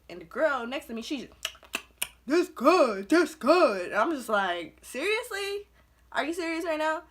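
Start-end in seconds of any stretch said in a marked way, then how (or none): 2.3-3.9 high, loud mock of talking through food; mouth-full imitation. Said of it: "talking with her mouth full". 4.8-7.0 high-pitched incredulous retort; mock disbelief. Acted disbelief at rude eater.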